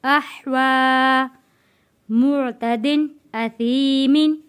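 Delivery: teacher chanting the verse for students to repeat; Quranic recitation. Shown as a demonstration. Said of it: "आप लोग भी मेरे साथ साथ रिपीट करें".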